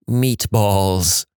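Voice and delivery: drawn-out word in a put-on patient voice; intoxicated patient voice. Patient answering every nurse question, imitated dazed voice. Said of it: "patient's vocabulary decreases to only".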